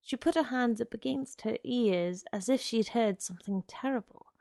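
ordinary delivery

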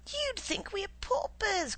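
high, slow indignant cry; cried out. A narrator's reading. Wife protesting her husband's stinginess, high and slow. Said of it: "cried his wife".

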